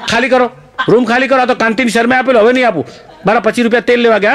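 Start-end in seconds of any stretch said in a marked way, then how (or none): 0.1-2.9 high-pitched fed-up landlord; hammed-up outrage, ordering the tenant out; indignant eviction. Uncle throwing the violinist out of the room, indignant.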